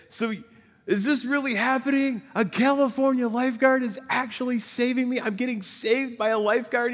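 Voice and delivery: high-pitched, amazed mock yell; retold yell. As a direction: act out calling to your rescuer in disbelief, voice way up. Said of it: "I yell and I say".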